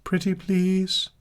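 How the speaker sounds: high, drawn-out pleading. Acted. Reading out a fan's request, high and pleading. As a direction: plead sweetly in a high, slow voice.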